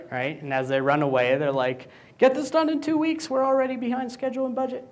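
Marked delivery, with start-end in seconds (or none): 2.2-4.8 much higher, fast parting order; mock designers. Designers running off from the code cave, high fast mock voice. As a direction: shout the deadline while running away. Designers fleeing after handing off.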